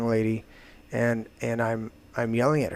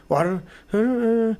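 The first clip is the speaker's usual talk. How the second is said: mocking excuses; 9 semitones higher than usual. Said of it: "just excuses and bullshit".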